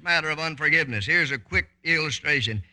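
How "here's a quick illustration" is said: usual speaking voice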